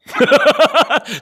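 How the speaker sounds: high, loud real laugh; sudden laughter at the awkward silence; burst of laughter. Real, not acted. Host laughs at his own pause.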